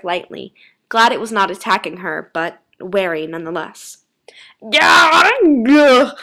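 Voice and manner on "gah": loud, high creature screech; agonised monster scream. A narrator's reading. Clothes devouring themselves, high loud scream. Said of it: "Her jacket and sweat screamed".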